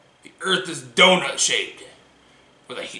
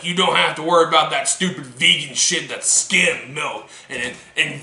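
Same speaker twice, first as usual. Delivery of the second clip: usual speaking voice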